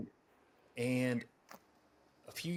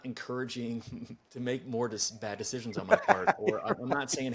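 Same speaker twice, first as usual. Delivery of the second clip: laughing; 2 semitones higher, 6 dB louder than usual. Real, not acted. Laughs at own joke.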